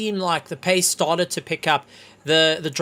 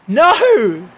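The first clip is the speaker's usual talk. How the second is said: loud strained cry; anguished cry; 6 semitones higher, 9 dB louder than usual. Real, not acted. Commentator crying out as Kruger drops, anguished. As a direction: let the disbelief burst out. Commentator sees driver drop out.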